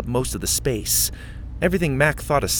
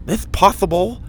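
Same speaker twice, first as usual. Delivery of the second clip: put-on higher, louder lisping kid voice; lisping boy; 10 semitones higher, 5 dB louder than usual. A narrator's reading. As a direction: give Mac his lisp. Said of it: "he said in his usual lisp".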